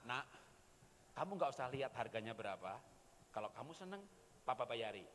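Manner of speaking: ditekan tenang, agak pelan; sabar lembut. Retold or imitated. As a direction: menahan marah dengan lembut. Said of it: "tekan rasa marah dengan kebaikan". (lembut menahan marah kepada anak)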